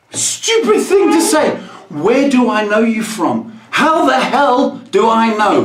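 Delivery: exasperated rant. Real, not acted. Ranting to the audience about a stranger, exasperated. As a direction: shout your disbelief at the silly question.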